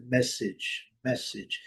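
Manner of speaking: "message" as "MESS-idge"; first syllable of "message" strong, short i ending; technical pronunciation. Shown as a demonstration. Teacher correcting a common word, "message" ending in short "idge". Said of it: "tecnicamente é essa pronúncia".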